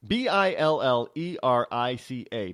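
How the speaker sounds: B I L L E R I C A letter by letter; spelling out. Shown as a demonstration. Host explaining the town's name, spelling out B I L L E R I C A. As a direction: spell B I L L E R I C A firmly, each letter separate. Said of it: "not spelling, pronunciation".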